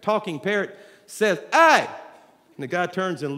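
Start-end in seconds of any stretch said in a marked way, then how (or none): 1.5-1.9 loud, high squawked hey; calling out. Acted. Talking parrot to man in pet store, calling out. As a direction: holler to grab a passerby's attention.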